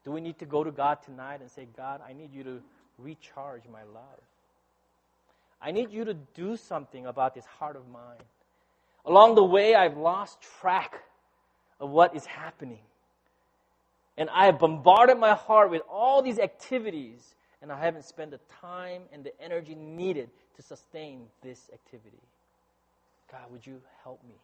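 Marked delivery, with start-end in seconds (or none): 1.8-8.2 hushed, low plea to God; prayer. Acted. Preacher praying for a recharged love, hushed prayer. 9.0-24.3 earnest prayer fading to a hush; confessional prayer. Acted. Preacher confessing lost track to God, prayer softening.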